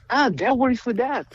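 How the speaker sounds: high, bright eureka voice; voicing his own aha moment; excited realization. Songwriter on a plane hearing the parts click, excited. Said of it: "and I'm like".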